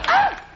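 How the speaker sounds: sudden very high shriek; startled scream. Acted. Comedian shrieking as Sasquatch grabs Betty. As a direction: shriek like something grabbed you.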